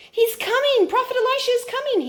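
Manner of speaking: put-on excited child's shout. The boy shouts to everyone that the prophet arrives, joyful high shout. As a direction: call it out like an eager little boy spotting a friend. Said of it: "he would shout".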